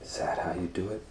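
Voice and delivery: usual speaking voice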